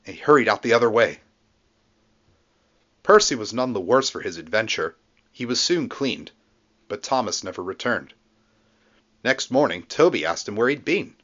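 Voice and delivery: ordinary delivery, nothing marked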